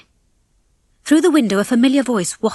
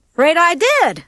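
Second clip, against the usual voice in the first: louder, higher, carefree admission; breezy; 8 semitones higher, 5 dB louder than usual. A narrator's reading. Rocky admits the promise to Ginger, breezy. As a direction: toss off the confession lightly. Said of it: "said Rocky breezily".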